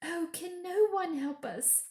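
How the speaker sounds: high, pleading wail. A narrator's reading. Lucy pleading to the beavers, high wail. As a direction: cry out for help. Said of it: "wailed Lucy".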